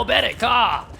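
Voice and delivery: rising excited play-by-play; esports caster. Real, not acted. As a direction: call the duel with building hype. Caster calls a clutch duel.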